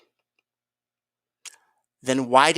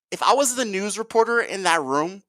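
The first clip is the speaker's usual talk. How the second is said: normal talking voice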